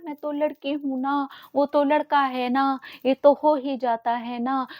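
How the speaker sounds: mock girl's excuse. Speaker parrots a girl's afterthoughts, mocking drawn-out excuses. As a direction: mimic a helpless girl, dragging each phrase. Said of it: "अगर बाद में यही सोचना है ना".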